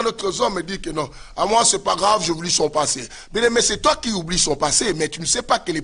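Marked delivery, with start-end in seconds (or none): none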